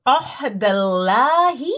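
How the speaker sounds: अहदल्लाही recited slowly with drawn-out ल्ला; Quran recitation. Shown as a demonstration. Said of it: "पढ़ाऊं आपको, कुरान से है ये". (धीमी तिलावत)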